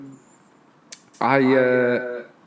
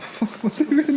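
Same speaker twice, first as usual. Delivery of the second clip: mock bewildered; 12 semitones higher than usual. Retelling confused moment on mountain.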